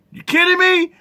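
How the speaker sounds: high-pitched disbelief; incredulous. Real, not acted. Reacting to the pastor's shushing, incredulous. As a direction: squeak it out, appalled.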